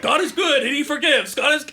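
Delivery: high-pitched imitation of a helpless priest; mock praying exorcist. Priest with nothing else to say mid-exorcism, mock pleading. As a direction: chant it as a useless, pleading exorcist. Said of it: "He's just like".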